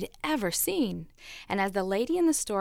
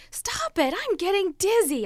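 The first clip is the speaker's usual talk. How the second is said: much higher, dizzy protest; mild mock scream; 8 semitones higher than usual. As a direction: cry out, spinning on the wheel. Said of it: "I screamed".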